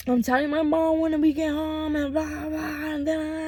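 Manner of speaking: mock yelling. Daughter threatens stepdad with telling mom, mock yelling. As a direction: imitate yelling without raising the volume. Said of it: "I started yelling at him".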